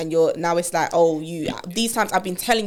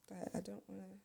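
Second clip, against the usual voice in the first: ordinary delivery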